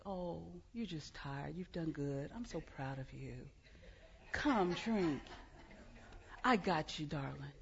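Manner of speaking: tender comfort. Pretend. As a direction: soothe him like a worn-out child. Imagined tender God consoling Elijah.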